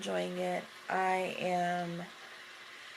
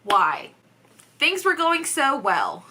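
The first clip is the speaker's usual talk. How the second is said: dismayed outcry; 6 semitones higher, 8 dB louder than usual. Real, not acted. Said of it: "I'm just like".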